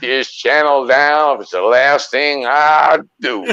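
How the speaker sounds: slow Southern American Kentucky drawl; Kentucky accent. Acted. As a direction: hold a slow hillbilly Kentucky voice, ham it up. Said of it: "you got a Kentucky accent going there".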